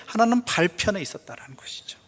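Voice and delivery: whispered "하나는 발편에 있었다라는 것이죠" with a falling close. Real, not acted. Pastor confiding the detail to the congregation in a whisper. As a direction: drop to a hushed whisper on "하나는".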